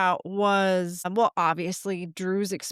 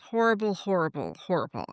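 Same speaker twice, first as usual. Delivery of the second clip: fading sorrowful repetition. Real, not acted. Mother recalling her son's awful call, fading heavily. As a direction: let the word sink as if it still weighs on you. Repeating the word, fading each time.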